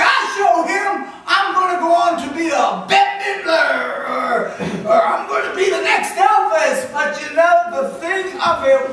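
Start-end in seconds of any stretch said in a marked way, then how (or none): 0.0-6.9 loud, indignant mock protest; indignant contestant. Contestant snaps back at Simon, loud and indignant. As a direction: shout it like an offended contestant. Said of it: "They would get angry".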